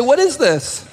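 high, shocked parent's question; a parent finding the mess, pitched well up. Retold or imitated. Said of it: "And you say".

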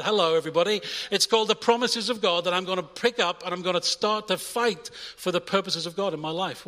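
loud, rising, fiery preaching; preaching at full force. Acted. Preacher urging the congregation to fight the enemy, full-force rousing preaching. Rallying the church to battle.

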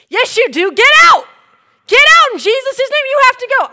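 very high, very loud commands; top-of-the-lungs exorcism command; furious shout. Retold or imitated. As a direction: bellow it at the demon. Shouting at the demon.

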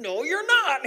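high, loud comic retort; mock fierce refusal. Daughter who won't quit wrestling, high mock-fierce retort. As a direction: jump in high and insistent.

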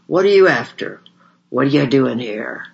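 loud, angry shout at the door. A narrator's reading. Brute shouting at visitors at his door, loud and angry. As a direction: bark it like a suspicious brute. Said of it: "scowled at us and shouted".

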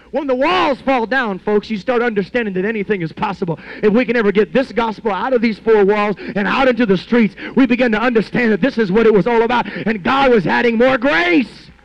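loud, high revival preaching; fiery sermon peak; preaching at full force. Acted. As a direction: ring it out to the whole church. Preaching climax to congregation.

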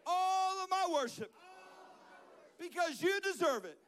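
high, loud call-and-repeat prayer; praying out loud. Real, not acted. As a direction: lead the crowd phrase by phrase, voice lifted. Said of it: "pray the simple prayer to God here today out loud".

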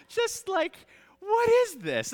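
high, loud exasperated shout; mock outrage. Dad mocking his own hurt, high exasperated shout. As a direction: cry out in comic disbelief.

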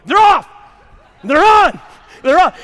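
high, hard shout, falling at the end; imitated yelling wife. Wife reports headlights to husband, imitated yelling. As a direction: shout a report back to the driver. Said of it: "So she's yelling at me".

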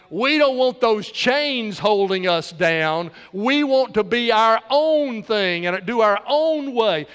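slow, loud, defiant mock voice of a rebellious world; defiant society. Preacher voicing society rejecting God, slow and defiant. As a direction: drag out the defiance like a crowd throwing off chains. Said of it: "now we are saying in our society".

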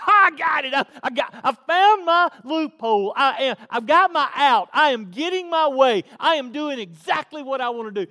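high, very loud, gloating glee; hammed-up giddy shouting; giddy triumph. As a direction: crow as if you found the loophole. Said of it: "He's almost giddy".

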